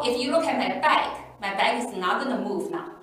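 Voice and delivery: ordinary delivery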